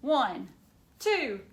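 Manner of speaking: lively storybook reading for a class; reading to children. Acted. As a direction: read it like a picture book to kids. Teacher reads picture book aloud.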